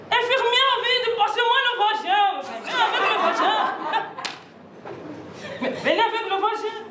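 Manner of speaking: high-pitched mock-urgent call to a nurse; surgeon calling nurse. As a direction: play an operating-room emergency for laughs. Joue une urgence au bloc opératoire. (appel aigu de chirurgien, faussement pressé)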